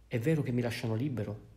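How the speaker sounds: usual speaking voice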